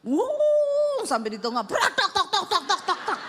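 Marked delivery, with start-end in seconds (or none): 0.0-1.1 high whooshing surge; a car lunging forward. Retold or imitated. Wife imitating the car surging up the ramp, a high whoosh. 1.6-3.2 high clattering tak tak; clattering crash noise. Retold or imitated. Wife imitating the exhaust pipe falling off, a high clatter.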